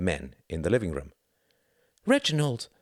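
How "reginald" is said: glad cry. A narrator's reading. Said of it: "she cried".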